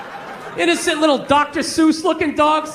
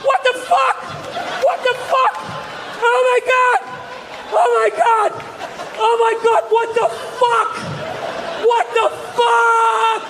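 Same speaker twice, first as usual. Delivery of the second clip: put-on young pilot shrieking as the man jumps out; rising panic; 9 semitones higher than usual. Passenger opens door, panic.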